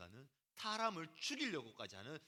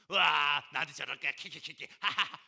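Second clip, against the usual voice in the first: cackling in mock delight, a touch louder; mocking laughter; 5 semitones lower, 9 dB louder than usual. Preacher voicing a mocking friend, snickering laugh. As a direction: tease him with a snickering laugh. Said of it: "이렇게".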